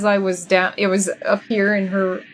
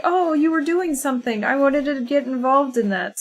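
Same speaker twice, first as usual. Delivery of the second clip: lightly raised, curious little cat voice; voicing the cat; 5 semitones higher than usual. Streamer voicing her cat who wants to join in, cute. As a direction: play the cat butting in, high and sweet. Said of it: "She was like".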